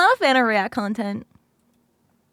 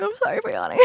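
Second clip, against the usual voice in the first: higher, softer, laughing through the apology; 8 semitones higher, 4 dB quieter, much faster than usual. Real, not acted. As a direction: giggle it out, still sleepy.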